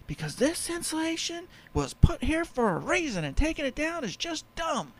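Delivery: hammed-up grumpy old-timer; cranky old man. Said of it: "And he's like".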